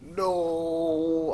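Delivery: high, wailing no; lightly voiced high despair; anguished no. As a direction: whine no, no, in grief. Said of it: "I was like".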